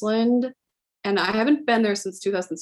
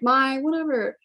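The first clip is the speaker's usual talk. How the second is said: mock dramatic whine; 5 semitones higher than usual. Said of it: "the exact same tone".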